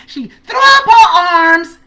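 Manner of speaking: high, drawn-out loud burst; slow, soaring celebratory holler; triumphant shout. Real, not acted. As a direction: stretch it into a victory yell. Re-enacting the victorious arm throw.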